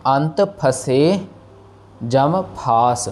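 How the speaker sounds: फंसे and फास with lips touching; slow, clear aspirated ph in फंसे फास; lips-closed pha. Shown as a demonstration. Teacher modelling फंसे फास for students. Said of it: "फ फ की आवाज़ आनी चाहिए".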